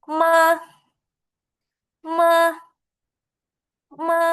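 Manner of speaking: MA loud, high and level. Shown as a demonstration. Teacher reviewing the first tone, MA high and stable. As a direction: hold MA steady up high. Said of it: "nada pertama".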